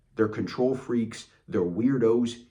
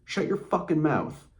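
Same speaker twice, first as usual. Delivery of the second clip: higher-pitched, fed-up telling-off; exasperated retort; 7 semitones higher, 3 dB louder than usual. Retold or imitated. Telling the backseat driver off, acted exasperation. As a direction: act out putting a nag in their place.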